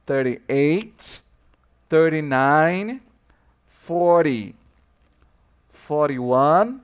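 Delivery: teacher modelling numbers for students to repeat; slow number drill. Shown as a demonstration. Teacher drilling English numbers for students, slow and clear.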